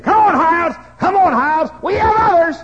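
loud impatient drill-sergeant yell; sergeant shouting. Retold or imitated. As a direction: bark it up the rope. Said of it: "the sergeant was saying".

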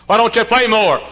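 high, loud imitation of questioners; mock nagging. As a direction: mimic pestering people loudly.

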